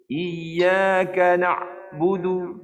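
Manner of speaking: slow chant, ain in "na'budu" fully stopped; wrong recitation. Shown as a demonstration. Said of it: "Macam tu ke".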